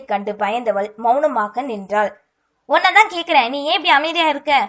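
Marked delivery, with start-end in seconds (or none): 2.7-4.5 higher, louder sharp demand; sounds angry. A narrator's reading. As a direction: press her hard for an answer. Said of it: "கோபமாக கேட்பது போல் தோன்ற".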